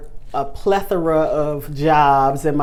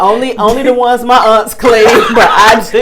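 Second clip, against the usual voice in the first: laughing shout; 5 semitones higher, 10 dB louder than usual. Real, not acted. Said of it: "You don't eat everybody's chitlins".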